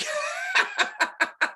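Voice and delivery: high shrieking laugh; a real, high shriek of laughter; burst of laughter. Real, not acted. Laughing at her tiny fee.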